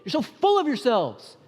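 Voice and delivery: hammed-up frustrated teacher scolding the disciples; mock scolding. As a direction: chew out the disciples in a pretend rant. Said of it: "doesn't chew them out and say".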